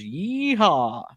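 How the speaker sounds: drawn-out, swooping cowboy whoop; mock yeehaw. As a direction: stretch out a joking cowboy cheer. Mock cowboy whoop after joke.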